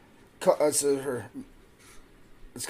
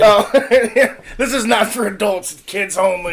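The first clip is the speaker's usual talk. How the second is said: laughing; 5 semitones higher, 9 dB louder than usual. Real, not acted. Laughing at own misread label.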